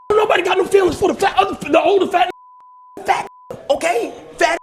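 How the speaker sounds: loud, heated scolding. Real, not acted. Scolding Drewski over the kid.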